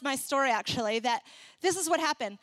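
ordinary talk, nothing marked

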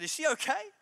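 a lightly voiced concerned stranger; concerned passenger. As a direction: play the worried passenger. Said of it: "The person in front of me said".

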